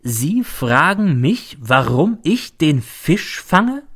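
SIE, FRAGEN, MICH, each slow and separately weighted; every word stressed. A narrator's reading. Companion questions narrator's question, slowly stressing each word from "Sie" to "fange". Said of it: "als betonte er jedes Wort".

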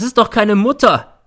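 high, loud outburst; angry shout. A narrator's reading. Son loses his temper at his mother, shouting. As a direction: let the temper break out. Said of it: "schreit er".

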